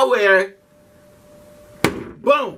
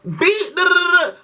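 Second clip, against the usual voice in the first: much higher, furious outburst; raging yell; 10 semitones higher than usual. Retold or imitated. Hurling books back at the bullies, yelling. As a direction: lose it and go off. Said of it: "throwing stuff back".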